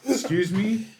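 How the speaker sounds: high-pitched incredulous query; a startled double-take; taken aback. Retold or imitated. Dad to daughter after her mispronounced word, taken aback. Shocked dad in retold exchange.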